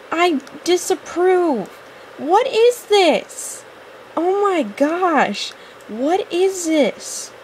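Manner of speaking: high, long-drawn dismay; dramatic disapproval. Real, not acted. Gamer disapproving of a creepy outfit, dramatically dismayed. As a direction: draw every word out high and aghast.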